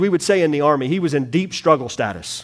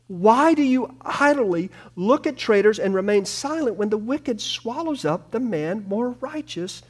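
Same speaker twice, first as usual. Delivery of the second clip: crying back to God; 8 semitones higher than usual. Acted. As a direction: protest to God that fades into bewildered hurt. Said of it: "Habakkuk crying back to God".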